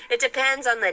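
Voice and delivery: high, loud words through laughter; laughing. Real, not acted. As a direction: say it while cracking up. Laughing at viewer comment.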